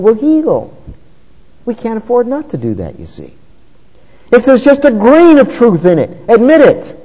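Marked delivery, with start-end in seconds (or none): 4.3-6.9 rising, louder sermon exhortation; preaching. Acted. Preacher exhorting his congregation, emphatic. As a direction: urge the flock to admit fault.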